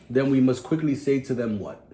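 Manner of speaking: normal talking voice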